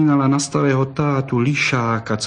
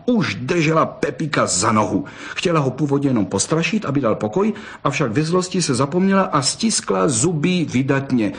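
usual speaking voice

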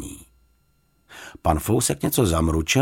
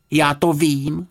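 put-on high, eager boy's call; lightly acted outburst; boy's exclamation; 6 semitones higher, slower than usual. A narrator's reading. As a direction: pipe up, pitched high. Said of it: "zvolal Jonatán".

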